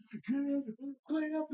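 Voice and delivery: high mock yell, pitch jumping way up; pretend yelling. Teen pretending to yell at friends, high mock shout. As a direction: bark orders at the others in a squeaky yell. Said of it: "I'd be start yelling at you guys".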